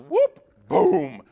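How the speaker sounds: rising whoop, then a loud boom; high whoop dropping into a booming thud. Retold or imitated. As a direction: act out a slip and crash in sound. Mimics the slip and fall.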